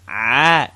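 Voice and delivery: "Ah" squeezed from deep in the throat; teacher modeling ain as "Ah"; throaty ain. Shown as a demonstration. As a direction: pull "Ah" up from the throat. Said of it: "pull that up from your throat".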